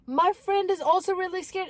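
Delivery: high-pitched imitated upset; tearful sister. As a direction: report it in a mock crying voice. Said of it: "both of them were crying".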